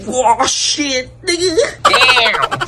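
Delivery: high, rising hyped-up yells; mock-shocked high exclaims; excited exclaiming. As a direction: react like you just saw the door slam into him. Reacting to door hitting guy.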